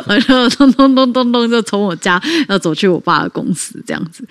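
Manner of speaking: real laughter runs through the words; laughing while telling. Real, not acted. 讲童年离家出走找爸爸时，边说边笑. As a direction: laugh as you recall marching off. Laughing at her childhood escape.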